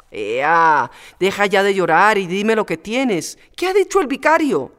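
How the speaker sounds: a brisk nurse pushing for the truth; Antoñona coaxing. Acted. Maid urging weeping Pepita to talk, insistent.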